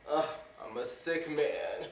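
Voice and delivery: self-pitying moan. Acted. Hypochondriac moaning.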